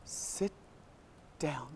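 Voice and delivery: very soft, halting, word by word; seething restraint. Acted. Early arriver silently fuming at the latecomer, seething. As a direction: hold the irritation down to a near-whisper.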